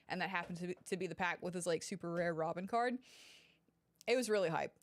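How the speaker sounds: usual speaking voice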